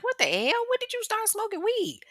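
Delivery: shocked voice rising high; shocked disbelief. Retold or imitated. Woman shocked boyfriend smokes weed, high disbelief. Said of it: "she's looking at him like".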